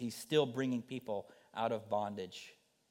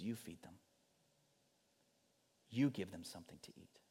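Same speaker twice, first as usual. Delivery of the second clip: much softer, calm and sure; gentle voice of Jesus; 3 semitones lower, 8 dB quieter than usual. Acted. Jesus calmly telling his disciples to feed them, softly. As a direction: hand them the task quietly.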